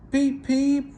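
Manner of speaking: high, loud whistle call; happy whistle peep. A narrator's reading. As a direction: toot like a happy engine. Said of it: "whistled Trevor happily".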